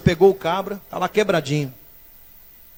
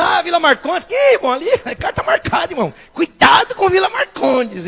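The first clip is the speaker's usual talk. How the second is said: high-pitched imitation of a worried gossip; mocked alarmist; 9 semitones higher than usual. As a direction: mimic someone warning in a high, put-on voice. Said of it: "eu vejo alguém dizendo".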